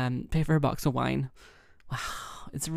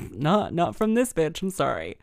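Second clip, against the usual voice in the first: sassy refusal; 3 semitones higher, 5 dB louder than usual. Retold or imitated. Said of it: "And I'm like".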